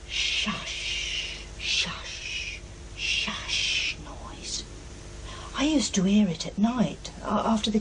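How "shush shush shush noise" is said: whispered shushing sound, breathy and soft; imitated dragging noise. Mother imitating the night noise for the professor, whispered shush. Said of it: "It was something like".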